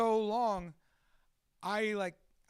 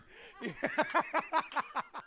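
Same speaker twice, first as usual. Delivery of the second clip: high staccato cackle; gleeful villain cackle; 7 semitones higher than usual. Acted. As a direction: cackle with hammed-up wicked delight. Ends the gleeful villain bit.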